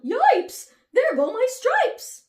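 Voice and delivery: yell. A narrator's reading. Zebra losing its stripes, loud high yell. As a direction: cry out in alarm. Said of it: "The zebra yelled".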